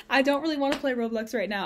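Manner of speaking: ordinary talk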